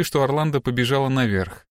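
plain reading voice

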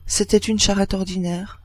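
usual speaking voice

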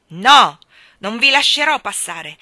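loud, high shouted refusal; furious. A narrator's reading. Miller blocking Wakem's way, shouting furiously. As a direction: block him with a yell. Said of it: "facendosi più furioso".

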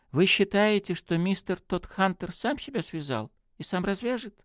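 high, puzzled priest asking for confirmation; embarrassed. A narrator's reading. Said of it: "смущённо сказал он". (смущённо переспрашивая, высоким голосом)

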